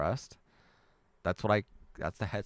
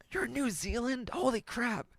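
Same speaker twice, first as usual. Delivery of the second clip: very high, astonished squeal; high-pitched, thrilled astonishment; amazed surprise. Real, not acted. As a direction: squeal in disbelief at the news. Surprised reading where viewer lives.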